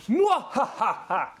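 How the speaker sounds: slow, high hammed-up evil cackle; villain laugh. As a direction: cackle like a sneering panto villain. Evil laugh at clever fix.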